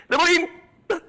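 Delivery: high yelp, loud and sharp; a real yelp of revulsion; disgusted yelp. Real, not acted. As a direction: cry it out in disgust.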